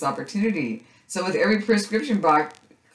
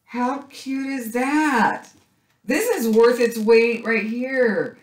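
slow, high delighted coo over a cute find; high gushing squeal; 8 semitones higher, 5 dB louder, slower than usual. Real, not acted. Crafter gushing to viewers over a cute sticker, high delighted coo. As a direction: melt over it in a high drawn-out voice.

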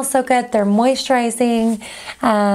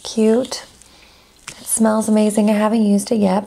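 normal talking voice

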